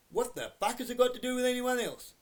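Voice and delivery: exasperated shout at the whole system; angry outburst. Real, not acted. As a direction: let the fury burst out on the question. Ranting at drug busts.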